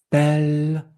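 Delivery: "pelle" held long on a high level note; long accented syllable. Shown as a demonstration. Teacher isolating the stressed syllable, held long. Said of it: "C'est plus long".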